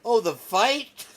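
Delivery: slow, loud disbelieving "Oh, the fight?"; stunned reaction, drawn out; incredulous shout. Real, not acted. Streamer reacts to confession clip.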